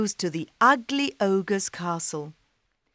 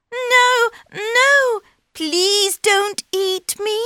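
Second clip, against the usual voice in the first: high, loud pleading squeal; frightened cat; 7 dB louder, slower than usual. Acted. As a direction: plead in terror.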